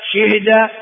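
شهد as shihda, kasra on the shin; shifted vowel. Shown as a demonstration. Teacher listing four verb variants, شهد said shihda. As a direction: say شهد as shih-da. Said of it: "أربع لغات".

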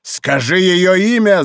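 high, slow, demanding cry; крик. A narrator's reading. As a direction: shout the demand, drawing it out. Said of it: "закричал монах". (требовательный крик)

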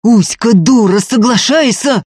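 loud, high, urgent shout; heated, bullying shout; yelling. A narrator's reading. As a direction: bellow at her to agree. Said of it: "орал Ефим".

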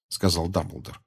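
plain reading voice

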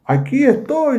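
high, ringing reply to the voice above; Abraham answering the angel, pitched high. Acted. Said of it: "le dice Abraham".

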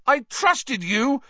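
high, loud, slow accusation; furious shout. A narrator's reading. Harry shaking with fury at Lupin, shouting. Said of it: "he shouted at Lupin".

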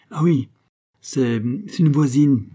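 stammering, slowed-down excuse; hesitant half-truth. Acted. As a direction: stall while inventing a lie. Narrator improvises a half-truth.

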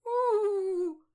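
loud, high drawn-out howl; witch's howl. A narrator's reading. Witch shut in the burning oven, horrible howl. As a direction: wail out long and high as the trapped witch. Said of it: "hurla-t-elle horriblement".

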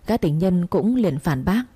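plain reading voice

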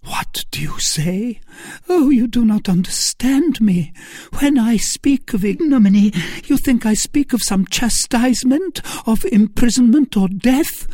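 high, impassioned protest; anguished lady. Acted. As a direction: start hushed, then cry out in despair. Narrator plays anguished Milady.

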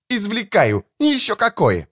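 high defiant snap; snapping daughter. Acted. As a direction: bite back at him. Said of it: "огрызнулась Мишель".

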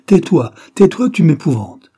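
high, loud, frightened cry to be silent; Laura cries out to the prophet to stop, terrified; terrified plea. A narrator's reading. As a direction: beg him to stop, full of dread. Terrified woman begs prophet stop.

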